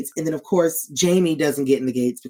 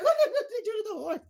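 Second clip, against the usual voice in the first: much higher and fast, laughing through the words; laughing disbelief; 5 dB quieter, faster than usual. Retold or imitated. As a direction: squeal it out mid-laugh at the horse. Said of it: "I was like".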